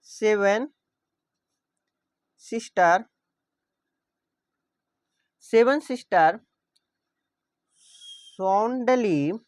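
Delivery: each word spaced out slowly; dictating an example to students; slow dictation. Shown as a demonstration. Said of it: "लिख सकते हैं seven".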